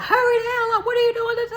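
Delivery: high, loud mock yell; mock sergeant yell. Drill sergeant yelling at a scared recruit, high mock yell. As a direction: hurry me down the rope, loud and high. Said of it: "she's like".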